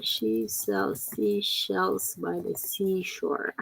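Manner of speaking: slow, careful tongue twister. Shown as a demonstration. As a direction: say each word slowly and clearly. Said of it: "can you guys say this one".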